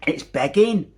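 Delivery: mum's voice. Retold or imitated. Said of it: "she said".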